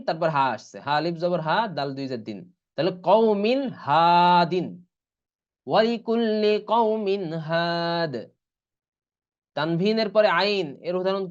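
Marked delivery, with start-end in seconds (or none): 3.1-8.1 slow, drawn-out "qawmin had"; clear tanween before ha. Shown as a demonstration. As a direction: recite "qawmin had", stretching "had". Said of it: "এর উচ্চারণ কেমন হবে". ("কওমিন হাদ" ধীরে টেনে পড়া)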